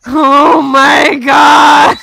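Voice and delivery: slow, loud, shrieking laugh; laughing shout. Real, not acted. Laughing outburst at the joke.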